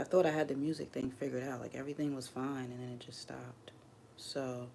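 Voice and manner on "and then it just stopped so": trailing into a whisper; real whispered disappointment. Real, not acted. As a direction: let it fade to a breathy whisper. Confiding a letdown.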